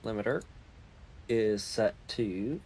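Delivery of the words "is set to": ordinary talk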